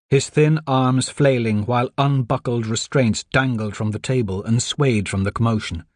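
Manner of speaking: ordinary delivery, nothing marked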